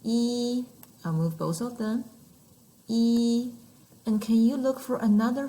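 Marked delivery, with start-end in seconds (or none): 0.0-0.6 "E" held high and level; first tone. Shown as a demonstration. Teacher demonstrating Mandarin first tone to learners, high and flat. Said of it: "First tone". 2.9-3.5 "E" held high and level; first tone. Shown as a demonstration. Teacher demonstrating Mandarin first tone to learners, high and flat. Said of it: "First tone".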